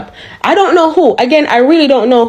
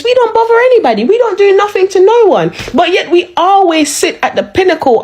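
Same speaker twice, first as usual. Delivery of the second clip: exasperated protest; 8 semitones higher than usual. Real, not acted.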